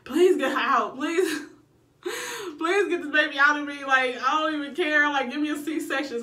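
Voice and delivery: high pleading, half laughing, half crying; begging. Retold or imitated. Mother in labour begging the doctor to get the baby out, high pleading. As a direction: beg the doctor. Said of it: "I'm like".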